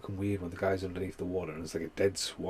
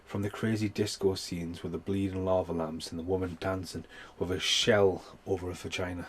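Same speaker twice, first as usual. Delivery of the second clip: normal talking voice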